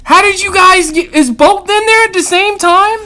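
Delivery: loud, high incredulous yelling; mock Marine yell. Marines yelling at two guys sharing a girl. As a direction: shout it like the whole room is hollering. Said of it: "All the Marines are like".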